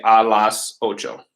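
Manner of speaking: slow, clear Spanish phrase; slow modelled Spanish. Shown as a demonstration. Teacher models the time phrase slowly for students. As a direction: say each word slowly for the class. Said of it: "we would say".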